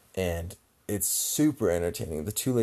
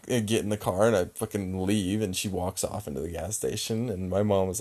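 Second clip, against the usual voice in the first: normal talking voice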